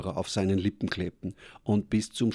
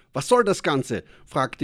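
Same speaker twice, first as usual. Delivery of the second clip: higher, louder indignant disgust; outraged; 7 semitones higher, 5 dB louder than usual. Acted. Man who spat out salted tea, indignant. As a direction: sputter it out, revolted.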